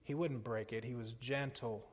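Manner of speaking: soft, low, slowed at the start; gentle. Real, not acted. Preacher describing Jesus with repentant sinners, gentle and hushed.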